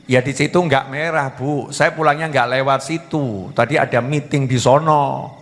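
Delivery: low-pitched, subdued excuse; acted husband making excuses; defensive husband. Acted husband makes excuses to wife.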